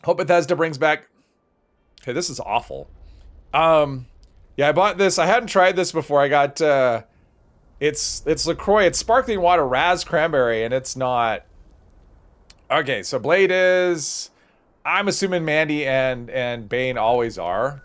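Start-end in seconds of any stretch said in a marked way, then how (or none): none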